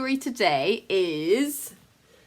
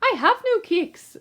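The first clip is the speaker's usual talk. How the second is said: much higher little rabbit voice; small rabbit pleading with the bandit; timid rabbit; 14 semitones higher, 5 dB louder than usual. Acted. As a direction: squeak it out, frightened. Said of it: "the rabbit replied".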